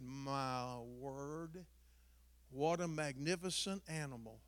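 low, slow, drawn-out "my word" in awe; admiring vet. Retold or imitated. Vet marvels at the dog, imitated admiring awe. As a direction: savor it softly, as an impressed vet. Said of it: "starts grinning. He said".

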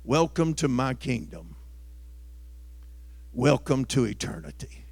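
a hint of a sly devil, hushed and low; lightly voiced devil greeting a guest; understated devil host; Satan's welcome. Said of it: "And Satan stands and says".

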